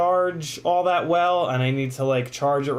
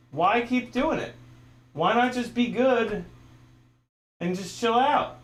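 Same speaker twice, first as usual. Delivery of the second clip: high, pleading with a pet; exasperated pleading; 8 semitones higher than usual. Real, not acted. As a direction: ask the animal why, exasperated. Pleading with animal.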